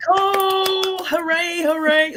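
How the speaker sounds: high, loud happy welcome; excited greeting. Real, not acted. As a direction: cheer her arrival. Greeting newly joined panelist.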